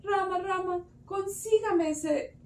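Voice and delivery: pleading request. A narrator's reading. Sita begs Rama for the deer, pleading. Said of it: "ella le pidió".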